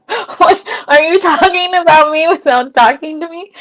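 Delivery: high, loud playful disbelief; mock outrage. Streamer catching chatters discussing her, in mock outrage.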